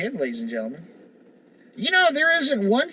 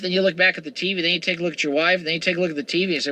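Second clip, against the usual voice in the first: ordinary talk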